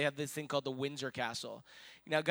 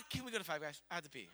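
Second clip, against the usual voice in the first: put-on unimpressed child; 7 dB quieter, much faster than usual. Daughter more interested in food than royalty, deadpan request. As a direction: drop all enthusiasm and ask quietly.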